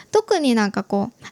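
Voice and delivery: normal talking voice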